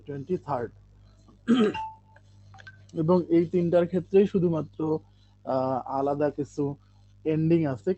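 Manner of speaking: usual speaking voice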